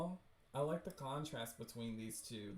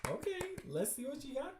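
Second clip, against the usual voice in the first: high, fast, eager dare; hyped challenge; 11 semitones higher, faster than usual. Real, not acted. Challenging the game character, hyped and eager. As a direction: egg the character on.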